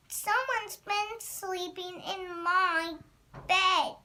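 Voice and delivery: high, slow little bear voice; Baby Bear crying out. A narrator's reading. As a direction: draw it out to a loud last word. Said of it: "cried the Baby Bear".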